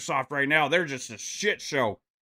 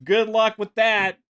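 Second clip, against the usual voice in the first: higher, slow sarcastic jab; sarcastic refrain; 5 semitones higher, 4 dB louder than usual. Real, not acted. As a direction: drag it out with biting sarcasm. Sarcastic refrain against Sony.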